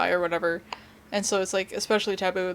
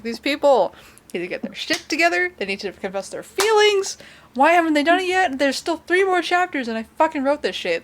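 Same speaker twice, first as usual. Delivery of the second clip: loud, high, worked-up venting; exasperated outburst; 8 semitones higher, 6 dB louder than usual. Retold or imitated. Reader railing at her own unfinished fan fiction, exasperated. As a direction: rant in mounting exasperation at your own characters.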